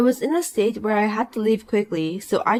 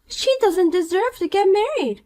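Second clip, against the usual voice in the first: put-on bright, higher voice of a smug mean girl; snide rich girl; 11 semitones higher than usual. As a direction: talk down to her with sweet contempt. Poppy sneers.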